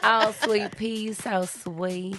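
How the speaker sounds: light Southern drawl on sweet pea; Southern accent. Pretend. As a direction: play up the Southern charm. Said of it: "We love your Southern accent".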